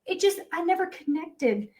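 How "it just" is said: laughing. Real, not acted. Laughs through emotional realization.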